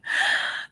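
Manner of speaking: sudden shocked intake of breath; a quick startled gasp; sharp gasp. Retold or imitated. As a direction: gasp in alarm at the sum. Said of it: "I don't want anybody going".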